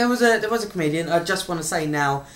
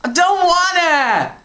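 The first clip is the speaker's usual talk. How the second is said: high, slow whining refusal; stroppy teen refusing to read; stroppy girl whine; 10 semitones higher, 5 dB louder, slower than usual. Pretend. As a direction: whine it like a sulky teenager. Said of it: "like a stroppy American girl".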